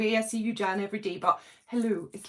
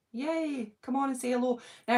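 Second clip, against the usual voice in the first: normal talking voice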